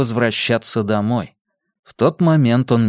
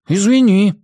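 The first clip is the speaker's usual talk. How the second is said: извинение подростка; 8 semitones higher, 5 dB louder than usual. A narrator's reading. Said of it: "с упрёком посмотрел на Бенни".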